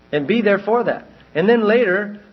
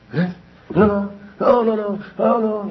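acting overwhelmed; 5 semitones higher than usual. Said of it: "You ever felt like that?".

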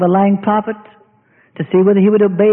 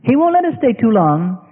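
ordinary talk, nothing marked

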